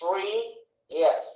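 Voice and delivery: ఇండస్ట్రియస్ slowly split as "ఇన్... డ... స్ట్రి... యస్"; four syllables. Shown as a demonstration. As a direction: pause after every syllable of ఇండస్ట్రియస్. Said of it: "అని వచ్చింది కాబట్టి four".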